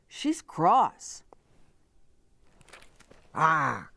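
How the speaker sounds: ordinary delivery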